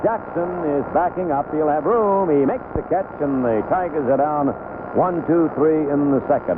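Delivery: normal talking voice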